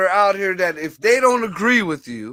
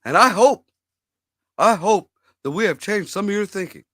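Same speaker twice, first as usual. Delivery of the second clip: a hint of Obama's speech cadence; Obama impression. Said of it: "I've been working on my Obama voice".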